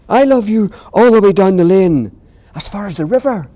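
high, loud joyful cry. Acted. Little hare calling out his biggest love, high loud joyful cry. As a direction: call it far down the lane. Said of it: "cried Little Nut Brown Hare".

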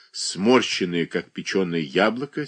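ordinary delivery, nothing marked

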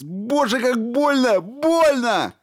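high, loud wail of pain; pained scream. A narrator's reading. Stevens screams at the robot's hands inside him, high wailing. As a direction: howl from the pain.

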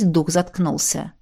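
usual speaking voice

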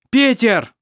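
loud, high call over the noise; shout. A narrator's reading. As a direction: yell his friend's name. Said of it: "крикнул Хейке". (громкий крик)

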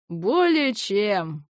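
slow, drawn-out smirk. Acted. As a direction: savour it with a grin. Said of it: "князь усмехнулся". (с усмешкой)

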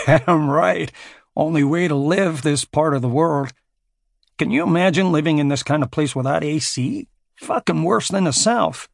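cocky American mercenary, mock apologetic; American contractor Klein. A narrator's reading. Klein teasing Jamie about the Scotch, in a brash American voice.

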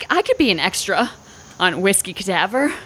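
ordinary talk

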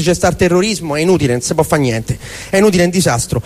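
usual speaking voice

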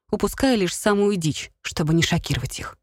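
ordinary delivery

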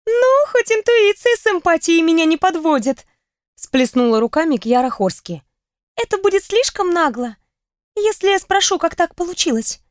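живой высокий девичий голос; лукаво допытываться; бойкая подруга. Acted. Реплика Кьяры. (бойкий голос подруги)

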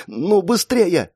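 high, drawn-out impatient plea; urgent urging. Acted. Girl pours energy into seal, urgent. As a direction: strain to hurry it along.

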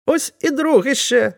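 high-pitched startled exclamation; frightened cry. A narrator's reading. Weaver discovers a second man in the sack, frightened cry. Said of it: "скрикнув злякано ткач".

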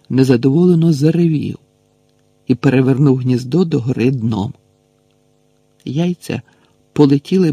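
plain reading voice

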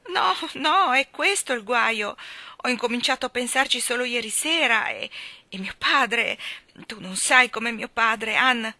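put-on young woman close to tears, high and pleading; distraught young girl. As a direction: play Dovi as a weepy, overwrought young bride. Dovi's voice in story.

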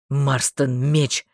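low, short bark; barked order. A narrator's reading. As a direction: bark the command in a deep voice. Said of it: "рявкнул он".

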